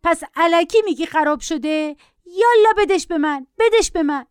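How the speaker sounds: put-on high-pitched, accusing child; shriller shrieking boy. A narrator's reading. Boy accusing his mother and demanding the tablet, shrieking. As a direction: demand it like a screeching little boy. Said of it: "جیغ پسر بچه بالاتر رفت".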